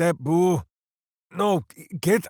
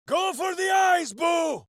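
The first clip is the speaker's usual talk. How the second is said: very high shouted rally; battle cry. Acted. Minsc rallying Boo into battle with a high shout. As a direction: fire Boo up for the charge.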